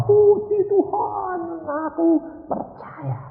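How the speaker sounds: high, drawn-out imitated praise; acted overwhelmed worshipper; crying-then-laughing praise. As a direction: stretch it out in a giddy, weepy high voice. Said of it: "Habis nangis kayak anak kecil, lalu bel-, ketawa-ketawa".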